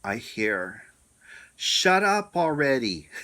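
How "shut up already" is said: booming command from above, loud and high. Retold or imitated. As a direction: boom it out like a voice from the sky. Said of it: "and real loud".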